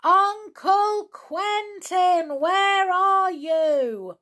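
high, slow, stretched-out holler; loud calling shout. A narrator's reading. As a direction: holler for someone far off, every syllable drawn long. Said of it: "They shouted again".

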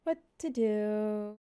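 slow, flat wooden line read; bad acting. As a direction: imitate a stiff actress, slowly. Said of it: "That's a great impression".

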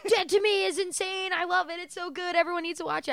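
high-pitched gushing, imitating an excited fan; hyped. Fan reading own old tweets, mock hyped. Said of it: "I was so hyped".

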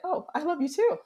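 high, gushing retold reply; sweet reply. Surprised sweet reply to stranger.

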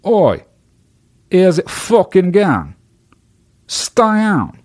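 very high, slow, hammed-up Australian; Australian accent. Shown as a demonstration. Host retrying Australian to get through to listeners, exaggerated accent. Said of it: "That's my fucking Australian accent".

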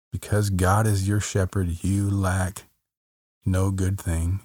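ordinary talk

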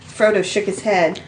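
plain reading voice

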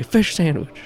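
high, punchy demand; bold, exaggerated food order; demanding mock order. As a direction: slam the order down on the bar. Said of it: "slap your hand down and you say".

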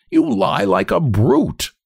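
high, drawn-out accusation; slow, high-pitched angry contempt; angrily. A narrator's reading. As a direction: call out a friend's lie with slow scorn. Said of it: "says the other tall one angrily".

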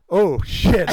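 loud startled exclamation; acted dawning shock. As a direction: reenact a sudden loud realization. Acting out his sudden realization.

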